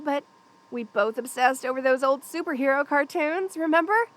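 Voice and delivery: ordinary talk, nothing marked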